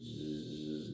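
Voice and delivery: long, low buzzing zzz; drawn-out buzz like a shell flying past; quick mimicry of a projectile whizzing by; imitated whizzing shell.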